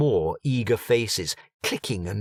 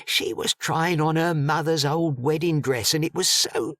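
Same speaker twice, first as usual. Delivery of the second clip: lightly voiced gossips, higher, chattering; gossiping townsfolk; 7 semitones higher than usual. A narrator's reading. Gossiping mourners trade rumours about the dead woman, higher and chatty.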